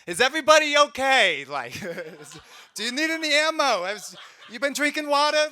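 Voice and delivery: high, loud halting Walken voice; doing Walken as the army medic; Christopher Walken impression. Medic checking on the squad, Walken voice. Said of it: "Christopher Walken just comes out".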